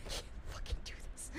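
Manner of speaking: breathless whisper through laughter; whispered panicked laugh. Real, not acted. Streamer hiding from a monster, whispered laughing despair.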